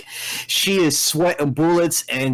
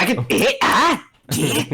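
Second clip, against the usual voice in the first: wordless hero grunts, pitched up; Link grunts; 4 semitones higher than usual. Pretend. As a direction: grunt like a silent game hero. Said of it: "I got that down".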